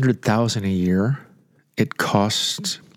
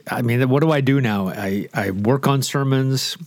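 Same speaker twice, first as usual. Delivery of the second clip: ordinary talk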